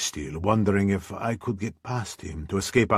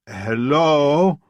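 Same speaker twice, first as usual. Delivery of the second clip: called out, louder and higher; calling out; 7 semitones higher, 6 dB louder than usual. Acted. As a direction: call into the empty pub. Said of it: "I called".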